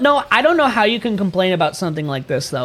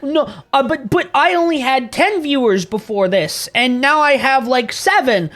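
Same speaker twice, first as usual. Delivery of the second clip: higher, pleading complaint; mock whiny streamer; 7 semitones higher than usual. Mocking streamers blaming Twitch for lost lurkers, whiny high voice. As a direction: whine like a sore small streamer. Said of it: "other people that were like".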